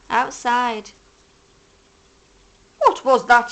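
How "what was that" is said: loud, startled call; startled badger. A narrator's reading. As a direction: badger jumps at the thud. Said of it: "he cried".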